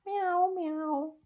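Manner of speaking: high, soft meowing cat. A narrator's reading. The cat Simpkin interrupts the singing mice with a meow. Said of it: "interrupted Simpkin".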